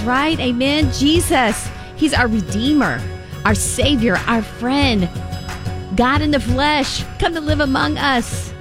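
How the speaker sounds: usual speaking voice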